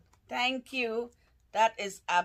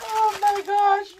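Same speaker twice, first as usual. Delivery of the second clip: slow, much higher and louder squeal; 8 dB louder, slower than usual. Real, not acted. Overwhelmed by a friend's wrapping, a slow high squeal. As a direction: let it fly up into a squeal.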